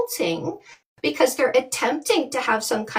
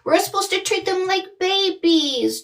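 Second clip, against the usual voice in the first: ordinary delivery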